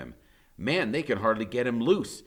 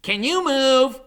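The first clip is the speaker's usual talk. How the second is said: anxious call; 9 dB louder than usual. Acted. Freak anxiously asking.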